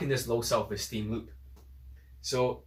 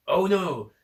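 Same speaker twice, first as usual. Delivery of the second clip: higher, louder mocking "oh no"; mock dismay; 4 semitones higher, 5 dB louder than usual. Coach imitating a complaining online critic, mock dismay. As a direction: put on an alarmed critic.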